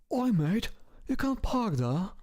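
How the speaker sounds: high, cheeky mock British accent, half whispered at the start. Streamer jokes with viewers in a hammed-up British accent. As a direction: play a goofy British bloke telling someone off, in a British accent. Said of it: "British accent is my favorite accent".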